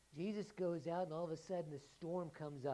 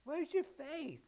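Jesus rebuking; 13 semitones higher, 9 dB louder, much slower than usual. Acted. Jesus rebuke repeated louder.

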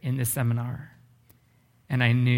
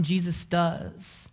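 drawn-out, higher emphasis on "Jesus does"; slow emphatic; 4 semitones higher, 3 dB quieter, much slower than usual. Real, not acted. Preacher to seminary students, stretching "Jesus does" slowly. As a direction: let "Jesus does" land slowly and high.

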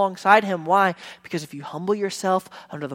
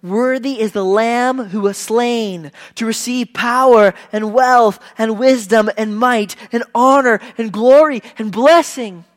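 loud, high ringing proclamation of the hymn; full-force preacher's proclamation, louder and higher; proclaiming scripture; 4 semitones higher, 10 dB louder than usual. Retold or imitated. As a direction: declare it to the whole church. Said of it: "it says".

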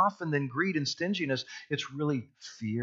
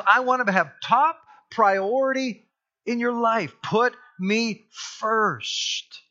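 voicing God; 8 semitones higher, 5 dB louder, slower than usual. Preacher voicing God's demand.

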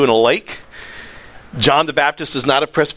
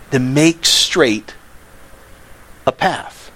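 slow weighty phrase; much slower than usual. Shown as a demonstration. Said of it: "we're a voice".

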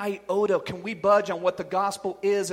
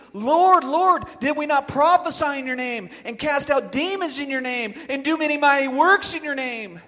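raised, higher and louder appeal; loud pleading; 4 semitones higher, 8 dB louder than usual. Acted. Rejected followers appeal to Jesus on judgment day, loud pleading. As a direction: plead your case loudly to the Lord.

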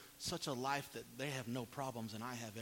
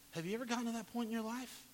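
ordinary talk, nothing marked

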